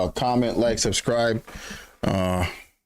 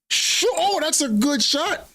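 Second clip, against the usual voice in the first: sudden much higher startled cry; surprised. Real, not acted. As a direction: caught off guard by the strike. Surprised by a shot on goal.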